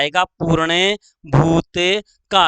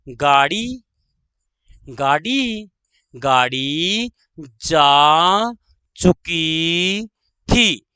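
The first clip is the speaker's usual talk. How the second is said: very drawn-out, word by word; slow dictation; much slower than usual. Shown as a demonstration. Teacher dictating the train example, slow dictation. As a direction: stretch each word, pause before the last.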